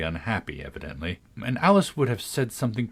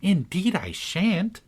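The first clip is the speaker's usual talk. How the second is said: impatient; 9 semitones higher, slower than usual. A narrator's reading. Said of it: "rather impatiently".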